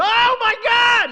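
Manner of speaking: high, loud, drawn-out shout; slow, high shout of pure amazement; boyish yell. Retold or imitated. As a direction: scream it like a kid who just hooked a bass. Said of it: "what I was yelling".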